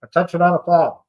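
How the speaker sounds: mock PA announcer call; imitating the camp tannoy; loudspeaker announcement. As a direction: drone it like a base loudspeaker. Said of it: "announcements that came over the loudspeaker".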